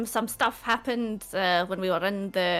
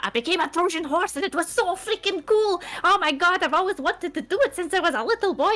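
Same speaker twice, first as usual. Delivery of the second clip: higher and louder, bursting with glee; too excited; 10 semitones higher, 5 dB louder than usual. Acted. Telling the party about the Trojan horse, giddy and bursting. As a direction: gush like a kid who got his wish. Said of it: "I'm getting too excited".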